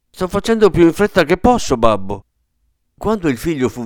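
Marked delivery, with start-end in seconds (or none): 0.1-2.2 fast, louder and higher, flustered; an old son hurried by his father, fast and higher; hurrying. A narrator's reading. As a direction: rush it out to please him.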